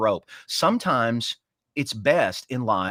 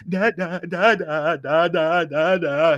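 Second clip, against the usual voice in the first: put-on higher, louder baby calling da da; imitating crying toddler; 6 semitones higher, 5 dB louder, slower than usual. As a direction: call out like a toddler left in his crib. Toddler crying for dad in crib.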